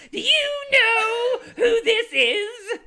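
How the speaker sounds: very high, loud, slow mock boom, laughing; imitating a deep pixie voice. GM mocking the pixie to the player, laughing. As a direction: ham up the pixie's booming demand. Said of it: "so you gave her a deep voice".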